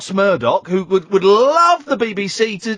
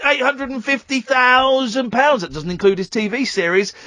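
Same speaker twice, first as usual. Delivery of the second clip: usual speaking voice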